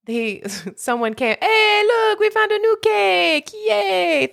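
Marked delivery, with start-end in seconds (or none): 1.4-4.3 high, bouncy mock cheer; a guest proudly announcing a new cake; chirpy partygoer voice. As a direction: sing it out like a clueless guest.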